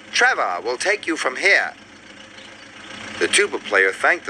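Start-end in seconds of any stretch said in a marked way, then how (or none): none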